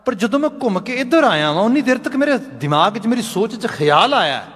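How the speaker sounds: high-pitched earnest explanation; acted impassioned confession; fervent realisation. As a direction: press the new insight with rising fervour. Craftsman explains his change of heart.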